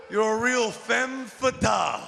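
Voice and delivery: normal talking voice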